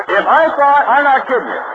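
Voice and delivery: loud outburst. Real, not acted.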